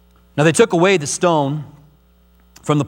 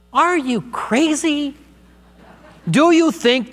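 much higher, slow disbelief; incredulous; 9 semitones higher, slower than usual. Real, not acted. Pastor answering a doubter about heaven, high slow incredulity. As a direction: stretch it out in open disbelief.

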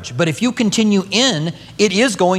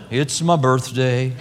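low, slow, mopey donkey voice; gloomy Eeyore; 5 semitones lower, slower than usual. Retold or imitated. Preacher doing Eeyore moping about his birthday, low and slow. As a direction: drag it out like a sad Eeyore. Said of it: "he's such an Eeyore".

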